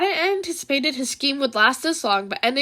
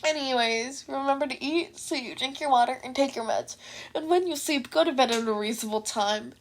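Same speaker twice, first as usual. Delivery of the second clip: much softer, caring; gentle sign-off; 7 dB quieter than usual. Real, not acted. The reader reminding listeners to rest, softer caring. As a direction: tuck the listeners in kindly.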